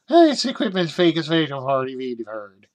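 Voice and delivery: garbled mock Irish-Norwegian lilt; goofy, muddled Irish-meets-Norwegian accent. Reader riffing on a misread word, mock Irish-Norwegian accent. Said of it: "that's my, uh, Irish, uh, Norwegian accent".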